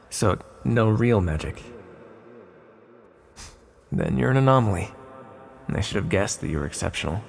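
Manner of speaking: usual speaking voice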